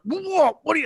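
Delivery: annoyed dad. Retold or imitated. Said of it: "he was very annoyed".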